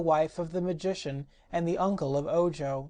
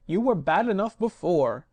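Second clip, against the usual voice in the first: louder, bitter tone; resentful; 5 dB louder than usual. Acted. Magician scolding the phonograph, loud and bitter. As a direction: grumble it with resentment. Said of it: "said the magician resentfully".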